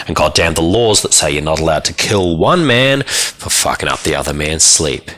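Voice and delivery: ordinary delivery, nothing marked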